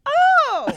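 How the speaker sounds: very high, loud "oh!"; hammed-up high-pitched cry; imitated woman's "oh". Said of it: "what's the woman that's like".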